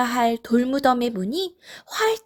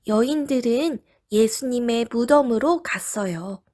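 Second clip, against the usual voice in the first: normal talking voice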